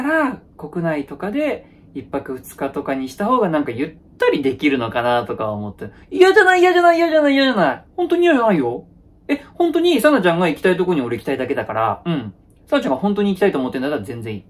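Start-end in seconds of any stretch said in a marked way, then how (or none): 6.1-8.6 much higher and louder, repeated over and over; hasty denial. Acted. Insisting to his girlfriend that he doesn't mind, in a flustered rush. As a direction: deny it fast before she gets upset.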